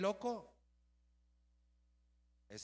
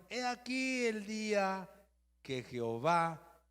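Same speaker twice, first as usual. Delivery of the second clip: raised, drawn-out announcement; dramatic proclamation; 6 semitones higher, 5 dB louder, slower than usual. Acted. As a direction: proclaim it slowly to your leader, voice lifted. David's men announce God's gift.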